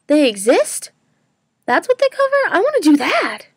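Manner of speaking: high, eager rising voice on the questions; excited discovery. Retold or imitated. Student retelling her eager reaction to a job perk, excited. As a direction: retell the thrill of finding a perk you want. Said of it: "and I was like".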